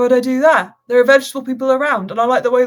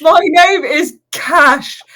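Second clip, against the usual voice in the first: tearful; 5 semitones higher, 6 dB louder, much slower than usual. Acted. NPC introduces himself tearfully.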